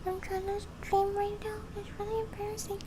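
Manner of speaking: high, soft polite plea; hushed complaint. Real, not acted. Asking the man mowing outside to stop, high and soft. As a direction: complain softly to the neighbour. Addressing noisy lawn mower.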